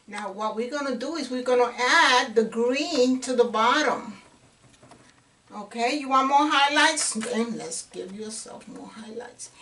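ordinary talk

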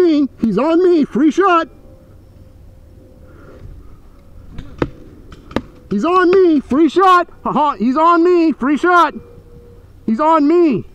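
high, loud repeated alarm; urgent callout. Real, not acted. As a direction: keep yelling for help. Repeated alarm under fire.

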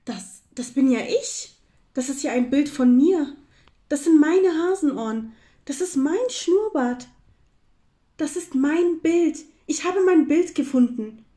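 excited, high and loud; jubilant. A narrator's reading. Hare finding his own picture, jubilant. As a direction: crow with delighted discovery. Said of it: "jubelte er".

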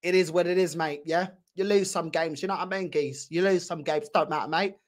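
mocking blasé fan. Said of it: "You see the reaction?".

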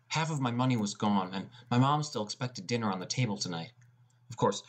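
ordinary talk

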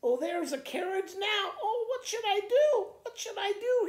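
very high, loud, laughing panic; panicking farmer. Acted. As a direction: squeal in comic panic as the carriage comes. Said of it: "he asked the dog".

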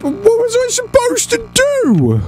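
high, drawn-out exasperated cry; exasperated wail. Real, not acted. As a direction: wail it in helpless protest, high and slow. Player exasperated by the game.

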